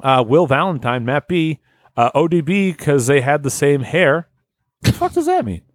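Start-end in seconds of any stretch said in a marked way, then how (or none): 4.8-5.6 high mock outrage.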